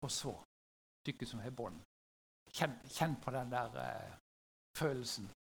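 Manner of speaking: low, soft, halting appeal; subdued, heavy with pauses; hushed gravity. Real, not acted. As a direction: let it sink in, low and quiet. Asks parents to feel the dread.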